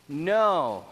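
NO drawn out, loud and falling; emphatic no. Shown as a demonstration. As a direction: hit a long, forceful NO. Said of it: "an emphatic no".

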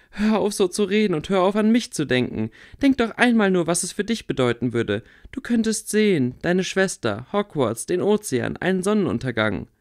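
put-on a stubborn girl heatedly laying out her logic; Theresa, insistent. A narrator's reading.

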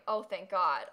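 usual speaking voice

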